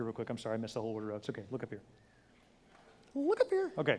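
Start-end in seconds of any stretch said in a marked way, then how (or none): 3.1-3.7 cheerful high call to the crowd. Real, not acted. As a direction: lift the voice to grab the room's attention.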